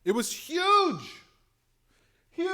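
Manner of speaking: high, loud and thrilled; big hyped-up exclamation; excited hype. Real, not acted. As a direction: shout the praise like a fan. Hyping Yang's giveaway.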